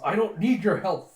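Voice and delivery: loud, indignant retort; mad. Retold or imitated. General to his helpers, mad and loud. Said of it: "he's getting madder hearing this".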